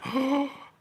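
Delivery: high gasp of disbelief; shocked gasp. Retold or imitated. Crowd hearing the news, gasping high. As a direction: gasp as if stunned. Everyone reacts again.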